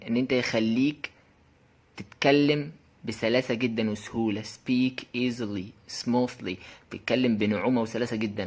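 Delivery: ordinary talk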